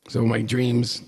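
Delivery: usual speaking voice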